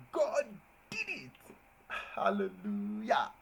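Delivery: joyful preacher-style cry; exclamatory praise. Shown as a demonstration. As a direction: shout praise like in church. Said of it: "That's an exclamation mark".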